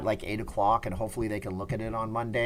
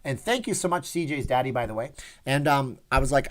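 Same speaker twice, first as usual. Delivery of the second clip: normal talking voice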